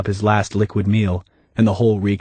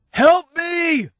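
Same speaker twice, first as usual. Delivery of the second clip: desperate cry. Acted. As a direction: shout up out of the grave. Dave buried, calling up.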